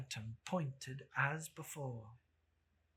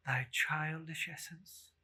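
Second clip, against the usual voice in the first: usual speaking voice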